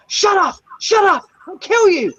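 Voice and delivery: high, sharp mock yell; imitated furious yelling. Driver imitating the passenger screaming at him, high mock yell. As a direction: bark it out like the raging passenger. Retelling Rambo's rage at driver.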